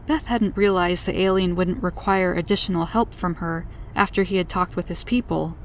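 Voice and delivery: usual speaking voice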